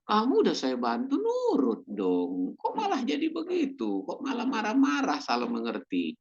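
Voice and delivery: high, exasperated scolding of an ungrateful relative; annoyed rebuke. Retold or imitated. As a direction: nag them, fed up that they won't obey. Acting out an annoyed helper's rebuke. (teguran kesal dengan nada tinggi)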